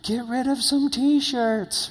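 high, slow voice playing his wife; wife's voice. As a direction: act out his wife telling him to clear out old shirts. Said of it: "The voice of my lovely bride is like".

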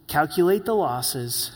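usual speaking voice

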